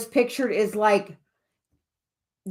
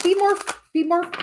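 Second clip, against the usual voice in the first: higher, coaxing mock guide voice; coaxing spirit guide; 8 semitones higher than usual. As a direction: urge them on in a pitched-up playful voice. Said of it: "she's like".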